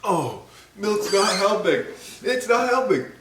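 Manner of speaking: high, desperate wail; real distress. Real, not acted. Chip eater finding milk useless, high desperate distress. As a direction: wail it high, the burn won't stop.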